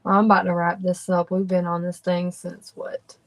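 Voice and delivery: ordinary talk, nothing marked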